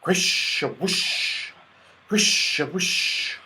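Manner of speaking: slow, airy whisper of rustling trees. A narrator's reading. The trees whisper loudly to each other, slow and airy. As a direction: breathe it out like wind in leaves. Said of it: "whispered the trees loudly".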